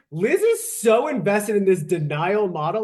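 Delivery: normal talking voice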